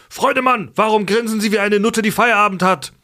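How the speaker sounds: high, mocking drill sergeant; barked drill commands. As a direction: sneer at the recruit, high-pitched. Drill sergeant mocks a recruit.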